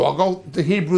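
ordinary delivery

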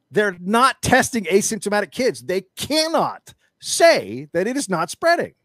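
loud, high, furious rant; angry rant. Real, not acted. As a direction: let the outrage boil over at the policy. Ranting about school covid policy.

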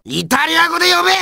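high, fast irate shout; angry demand. Acted. Insisting on the Italian name, angry shout. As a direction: demand it in exasperation. Ranting about Venice's English name.

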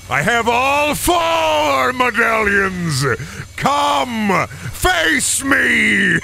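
high, drawn-out shout; mock-epic challenge. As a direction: holler a slow, hammed-up challenge way up high. Mock boss challenge in game.

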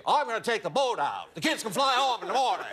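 put-on high, booming, absurdly broad Kennedy accent; mistaught Ted Kennedy accent. Actor in an intimate limousine scene, wildly overdone Kennedy accent. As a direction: belt the line in an overblown Boston accent. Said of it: "and I say".

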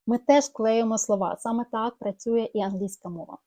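usual speaking voice

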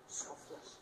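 whispered word. Acted. Preacher contrasting selfish and selfless, a whisper.

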